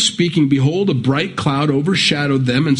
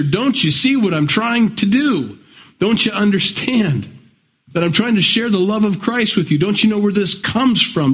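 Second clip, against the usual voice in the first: exasperated pleading; 6 semitones higher than usual. Retold or imitated. Preacher voices frustrated Christians to unbelievers.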